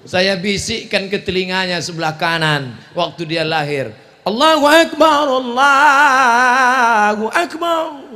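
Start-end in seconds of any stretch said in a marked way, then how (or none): 4.2-8.0 loud high slow azan; loud chanted azan; loud call to prayer. Shown as a demonstration. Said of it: "Tapi tidak kuat macam itu".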